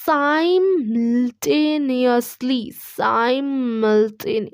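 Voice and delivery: simultaneously drawn out very slowly, sigh-mul-TAY-nee-us-lee; slow model word. Shown as a demonstration. Teacher drilling simultaneously with the class, very slowly. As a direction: stretch simultaneously out slowly for students to copy. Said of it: "करो".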